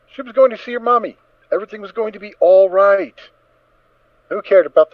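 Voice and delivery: thrilled at seeing mommy soon; bubbling, giddy delight; excited. A narrator's reading. Filly's joy in narration.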